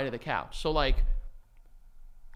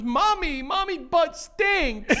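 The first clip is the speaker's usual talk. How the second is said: high, drawn-out mock wail; crying little girl; 11 semitones higher, much slower than usual. Daughter tattling on mom on the couch, mock crying. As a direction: whine like a child who just bumped her head. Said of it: "crying as if she just".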